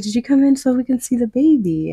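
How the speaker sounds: receptionist's excited guess. Retold or imitated. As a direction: ask brightly, thrilled. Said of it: "She's like".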